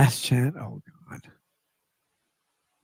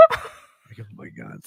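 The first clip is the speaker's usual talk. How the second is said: laughing; 5 semitones lower, 12 dB quieter, slower than usual. Real, not acted. Laughing after rant.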